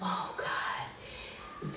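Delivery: breathless whisper of dread; whispered realization. Retold or imitated. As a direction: whisper it in horror.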